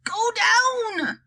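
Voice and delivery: high, drawn-out grandpa yell; high-pitched storybook yell; yelled order. A narrator's reading. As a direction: call it out high, as if over noise. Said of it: "yelled Grandpa George".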